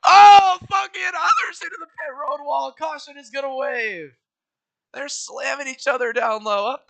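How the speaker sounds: high, loud frantic wreck call; excited shouting. Real, not acted. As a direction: yell the crash as it unfolds. Big wreck, shouting commentary.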